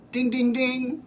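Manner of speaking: high, sing-song ding ding ding; playful high quiz-show chime; mock game-show buzzer. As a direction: ring the wrong-answer bell in a mock voice. Mocking buzzer at wrong answer.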